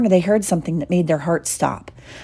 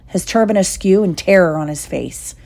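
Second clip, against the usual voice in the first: plain reading voice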